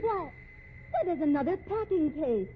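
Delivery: loud, high gasp of shock at the crate; startled outcry. Acted. Woman finds another crate in the office, shocked outcry. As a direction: cry out in shocked alarm. Shocked discovery of second case.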